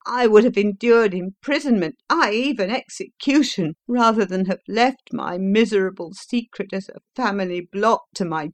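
passionate prisoner. A narrator's reading. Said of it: "cried the prisoner passionately".

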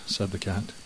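usual speaking voice

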